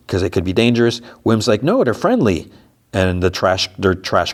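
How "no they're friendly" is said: higher-pitched mock protest; lightly acted, high-pitched insistence. Voices a child protesting in recap.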